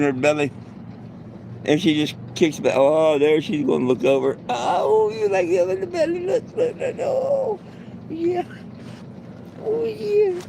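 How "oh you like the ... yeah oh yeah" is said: very high falsetto cooing; doting baby talk. Pretend. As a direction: coo way up high during a belly rub. Cooing during a belly rub.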